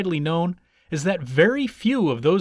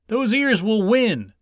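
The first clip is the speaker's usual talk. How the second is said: higher, slow proclamation; confident cheer; 6 semitones higher, 4 dB louder than usual. A narrator's reading. Hare's friends as he runs on, proclaiming. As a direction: cheer with total faith.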